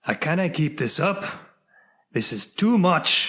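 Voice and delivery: mock Scottish accent. Joking Scots struggling with their accent.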